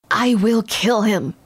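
plain reading voice

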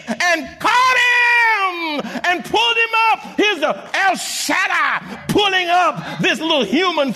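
high, stretched preacher's cadence swelling to shouts; chant-like proclamation, drawing out the key words; full-force preaching. Real, not acted.

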